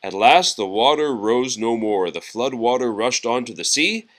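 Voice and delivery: usual speaking voice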